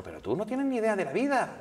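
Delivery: put-on high dismissive Andalusian accent. Singer telling the reporter he knows nothing, Andalusian accent. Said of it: "con su acento así".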